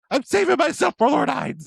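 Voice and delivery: high, hammed-up impassioned outcry; passionate declaration. Host voicing Albedo defending her devotion, passionate high cry. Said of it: "very passionately about that".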